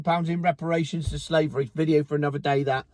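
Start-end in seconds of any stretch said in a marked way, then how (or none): none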